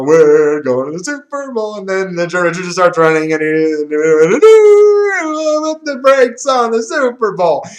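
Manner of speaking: loud mock hype climbing to a drawn-out high wail; joking freak-out. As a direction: build into a hammed-up, stretched-out scream. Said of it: "seeing me freaking out like that".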